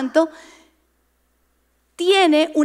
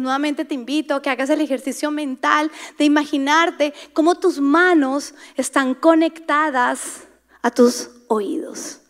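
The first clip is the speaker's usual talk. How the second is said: usual speaking voice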